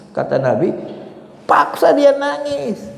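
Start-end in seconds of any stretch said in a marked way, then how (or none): 1.5-2.8 high, drawn-out urging; forceful command. Acted. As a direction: order it insistently, stretching the words. Voices the Prophet's forceful command. (perintah tegas bernada tinggi dan panjang)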